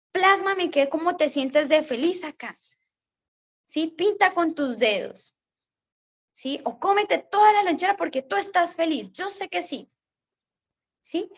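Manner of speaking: cheerful encouragement. Retold or imitated. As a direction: cheer the kid on with a lifted voice.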